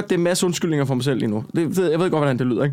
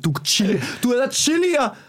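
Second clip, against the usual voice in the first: high-pitched, incredulous outburst; worked-up rant, voice pitched up; retold angry rant; 6 semitones higher than usual. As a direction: replay chewing out a fellow performer. Retold rant at Chili Claus.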